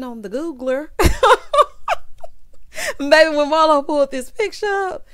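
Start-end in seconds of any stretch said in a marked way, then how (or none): 0.9-2.9 loud high laugh. Real, not acted. Recapping Marlo pulling up the picture, loud cackle. 3.0-5.1 laughing through words. Real, not acted. Laughing while recapping.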